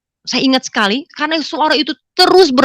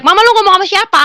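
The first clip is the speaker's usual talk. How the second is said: higher-pitched calling out; mildly raised, high questioning call; retold husband's shout; 10 semitones higher, 4 dB louder, faster than usual. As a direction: call across the house as the husband. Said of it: "Suami saya teriak".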